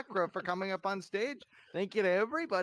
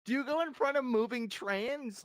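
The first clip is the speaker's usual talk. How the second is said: high character voice; 9 semitones higher than usual. Acted. Character answering the official.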